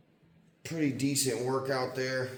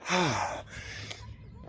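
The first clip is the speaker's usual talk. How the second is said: exhausted sigh; 7 semitones higher, 4 dB quieter than usual. Real, not acted. Finishing a hard run.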